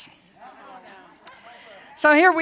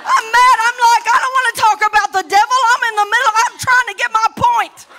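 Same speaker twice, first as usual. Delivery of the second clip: louder, higher, fast complaint; retold indignant protest; 7 semitones higher, 7 dB louder, faster than usual. Wife protesting her husband's prayer mid-argument, indignant. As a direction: huff it out like an interrupted wife. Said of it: "And I was mad".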